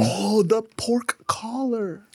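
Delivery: slow, hushed whisper of awe; breathy reverent hush; awed whisper. Real, not acted. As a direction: whisper it slowly, savoring each word.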